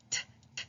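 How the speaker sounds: short unvoiced taps, t t t; tapping T sound. Shown as a demonstration. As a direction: tap the tongue, t. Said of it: "it's a tapping sound".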